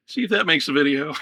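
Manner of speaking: shouting through a laugh. Real, not acted. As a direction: laugh it out, still rattled. Laughing after the shock.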